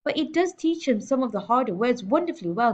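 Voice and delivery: usual speaking voice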